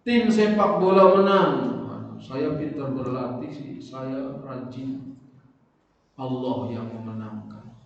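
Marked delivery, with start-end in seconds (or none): none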